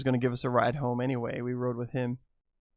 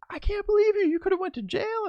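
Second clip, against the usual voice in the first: mock angry wife. Wife scolding him over risking jail, in a high mock-angry voice. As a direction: squeal it out like a furious spouse.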